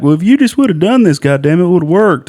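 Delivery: imitating Doctor Phil's twangy scolding; Doctor Phil impression. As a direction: ham up Doctor Phil's blunt advice. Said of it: "It was like a Doctor Phil kick in the nuts".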